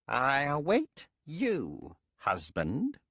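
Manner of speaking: high, slow, eerie witch. Acted. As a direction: speak as a cold, proud witch.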